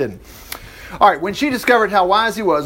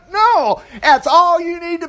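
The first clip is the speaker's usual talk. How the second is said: cheerful no; 11 semitones higher than usual. Acted. Cheerful answer.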